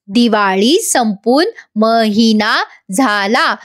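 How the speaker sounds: put-on slow, word by word, like to a small child; teaching slowly. Gauri teaching Madam a sentence, slow and patient. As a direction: spell out each word for a learner. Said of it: "अगदी लहान लेकरासारखं समजवत".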